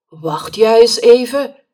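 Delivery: put-on loud shout after the boy; called out. A narrator's reading. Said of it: "riep de mevrouw".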